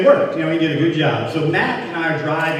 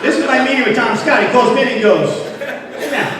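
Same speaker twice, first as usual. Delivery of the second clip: laughing; 9 semitones higher, 8 dB louder than usual. Real, not acted. Laughing retelling meeting.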